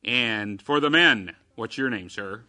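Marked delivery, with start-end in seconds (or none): none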